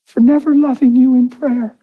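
high imitated tearful confession; bawling wife. Wife looking husband right in the eyes, high mimicked crying. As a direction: confess in a wife's high, weepy voice. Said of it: "And she's bawling".

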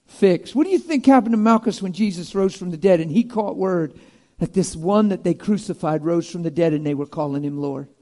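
normal talking voice

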